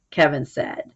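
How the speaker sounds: ordinary talk, nothing marked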